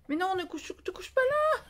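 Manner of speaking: a despairing laughing plea, voice cracking high; exasperated high cry. Real, not acted. To her cat lying on the paper, high laughing wail. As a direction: pitch shoots way up, half laughing, half wailing.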